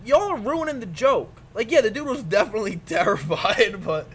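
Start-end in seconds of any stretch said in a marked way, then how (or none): 0.0-1.4 scolding. Acted. Scolding the internet.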